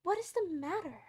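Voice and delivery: high-pitched, urgent asking; alarmed question. A narrator's reading. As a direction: ask in startled concern. Alice alarmed by the Queen's screams.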